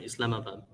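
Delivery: soft, low practice of "Islamabad"; practised pronunciation. Real, not acted. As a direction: try out "Islamabad" quietly, as just taught. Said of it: "I'll be able to say it".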